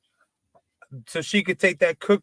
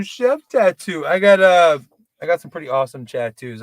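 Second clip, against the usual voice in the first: ordinary talk, nothing marked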